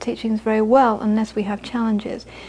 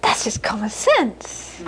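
imitated mother; 4 dB quieter, slower than usual. Mother sums up daughter's Buddhist stuff, slow matter-of-fact imitation. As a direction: play the mother brushing it off slowly. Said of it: "She'd say".

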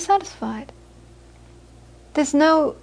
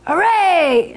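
higher-pitched mock hooray; acted cheer; 6 semitones higher than usual. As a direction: cheer like a fan at a goal. Acting out cheering at a goal.

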